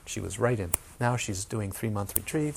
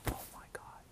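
breathless, hushed gasp of recognition; awed whisper; 18 dB quieter than usual. Retold or imitated. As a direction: whisper it in sudden awe. Awed student realising freedom.

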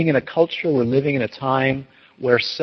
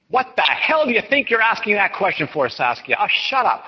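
high, scolding mock tirade; playful imitation of an angry teacher; mock angry hollering; 8 semitones higher than usual. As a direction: holler at the student to rile them up. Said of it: "lecturing and hollering at you right now to get you all mad".

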